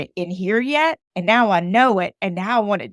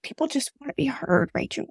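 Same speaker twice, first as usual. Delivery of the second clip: lightly voiced God, soft and higher; gently voiced God speaking to Rachel, softer; 7 semitones higher, 6 dB quieter than usual. God tells Rachel softly, a light higher voice. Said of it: "And he said".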